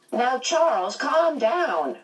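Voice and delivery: high-pitched, swooping urging to calm down; chiding old friend. A narrator's reading. Missus Watson calming Charles, in a high, chiding voice.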